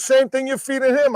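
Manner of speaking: high-pitched retort, acted out; bantering comeback. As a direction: toss the answer straight back.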